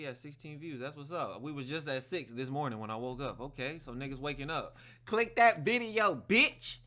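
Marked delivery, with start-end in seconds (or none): 5.0-6.8 shouting. Real, not acted. Yelling at viewers to click.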